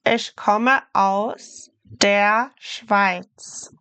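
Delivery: Ich komme aus der Schweiz spoken slowly and clearly; slow teacher demo of the sentence; careful, slow German; slow model sentence. Shown as a demonstration. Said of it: "we would say".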